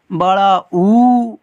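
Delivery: बड़ा ऊ stretched slow and loud; बड़ा ऊ dragged out very slowly; long drawn-out oo. Shown as a demonstration. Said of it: "बेटा इसको ऐसे पढ़ते हैं".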